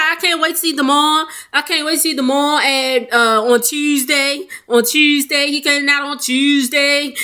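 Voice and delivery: high, repetitive imitated gushing; mock excitement. Mother awaiting her son's release, imitated excitement. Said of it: "I'm so excited".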